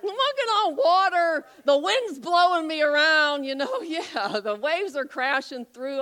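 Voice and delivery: high, loud, thrilled shout; excited Peter. Retold or imitated. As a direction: play Peter exulting on the waves. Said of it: "Peter's voice, retold".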